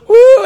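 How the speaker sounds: high, loud party whoop; hammed-up drunk woo; drunken whoop. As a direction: whoop like a wasted college kid. Mock drunk party cheer.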